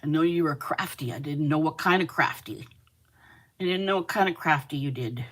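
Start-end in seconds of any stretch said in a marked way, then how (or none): none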